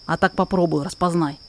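normal talking voice

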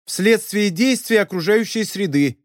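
loud answer. A narrator's reading. Ribs repeats Oscar's whispered prompt to the teacher, loudly. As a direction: announce the borrowed answer out clearly. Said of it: "громко произнесла Рипс".

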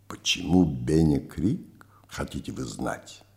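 breathy low whisper; hushed conspiratorial whisper. Acted. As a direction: whisper it like a secret. Old man asks quietly in cemetery.

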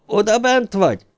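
high-pitched call through a door. A narrator's reading. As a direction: call out to someone shut inside. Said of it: "hogy a nevét kiabálják". (magas hangon bekiabálva)

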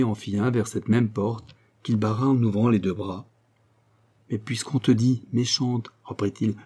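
ordinary delivery, nothing marked

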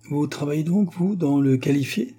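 the thug sizing up Fritz, lightly cynical. A narrator's reading. Thug questions Fritz's honesty, mild cynical sneer. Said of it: "dit-il d'un air cynique".

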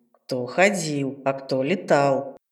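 low, slow snide grumbling; snide grumble. Acted. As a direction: grumble it with a smirk. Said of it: "ехидно проворчал Джастин". (ехидное ворчание)

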